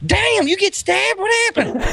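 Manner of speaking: gawk at the wound like a random dude; mock passerby gasping at a stab wound; surprised passerby. Dude passing by remarks on wound.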